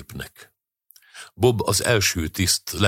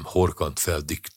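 ordinary delivery

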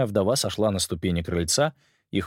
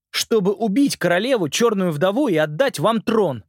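put-on higher, louder earnest boy voice; a boy urgently pleading his case; 10 semitones higher, 4 dB louder than usual. Simon's lines.

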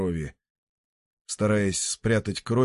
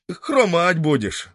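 выше, громче, медленно, с издёвкой; утешь с насмешкой; насмешливое утешение; 9 semitones higher, 5 dB louder, slower than usual. Acted. (зритель насмешливо утешает мальчика)

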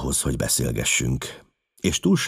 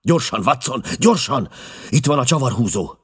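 high, loud, hurried call; excited commands, pitched up; urgent shout; 9 semitones higher, 4 dB louder than usual. A narrator's reading. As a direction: shout for haste. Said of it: "kiáltotta Holmes".

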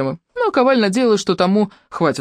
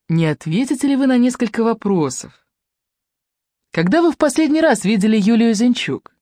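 higher, overly polite question; a hint of unctuous politeness; 6 semitones higher than usual. A narrator's reading. Юнец presses Kovál with sickly politeness. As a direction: smother it in courtesy. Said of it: "с отвратительной вежливостью сказал юнец".

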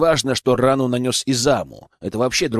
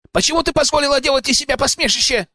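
much higher, fast indignant challenge; indignant outburst; 13 semitones higher, faster than usual. Acted. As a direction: spit out the accusation fast. Akira bursts in accusing.

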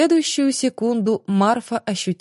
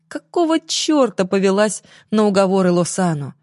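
usual speaking voice

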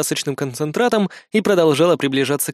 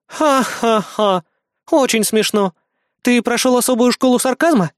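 mock his joke in a higher female voice; deadpan ha-ha, pitched up; sarcastic; 8 semitones higher than usual. Кри язвит в ответ мальчику.

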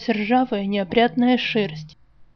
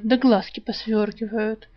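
usual speaking voice

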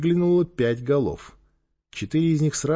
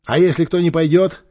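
raised, louder order; commanding; 4 semitones higher, 6 dB louder than usual. A narrator's reading. Тимур to his team about prisoners, commanding. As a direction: call out the order firmly.

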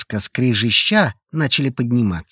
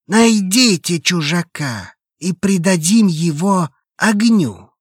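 higher, louder solemn cult preacher; hoarse solemn voice; 8 semitones higher, 4 dB louder than usual. Acted. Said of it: "— продолжил Лукин". (хриплый торжественный голос проповедника)

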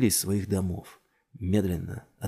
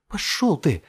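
high, loud curse; furious raised yell; angry defiance; 8 semitones higher, 5 dB louder, slower than usual. A narrator's reading. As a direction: spit back defiance mid-struggle. Fighting off the attacker.

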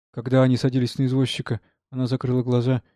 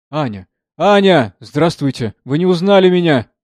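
eager greeting in a raised voice; urgent calling out; 3 semitones higher, 8 dB louder than usual. A narrator's reading. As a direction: call her name, then louder. Man calls out to weeping girl. (взволнованно окликая)

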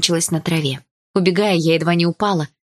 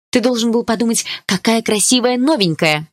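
petulant; 6 semitones higher than usual. A narrator's reading. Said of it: "топнула я ногой".

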